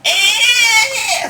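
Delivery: very high, shouted mock yelp; mock guitarist freaking out; weird freakout yelp. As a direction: squeal like a guitarist losing it. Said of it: "freaking out with this weird".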